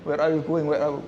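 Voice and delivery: a hint of English-speaking Moses; mock English. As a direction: ask it like an English interviewer. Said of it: "Kayak bahasa Inggris aja".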